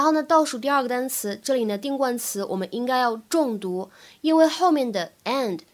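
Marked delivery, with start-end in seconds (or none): none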